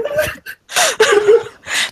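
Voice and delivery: real high-pitched cracking up; high laughter. Real, not acted. Game master tickled by the duck image, high laughter.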